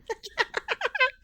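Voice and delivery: high, giddy burst of laughter; delighted laugh. Acted. The newcomer laughs with joy at the door. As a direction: laugh out loud with glee.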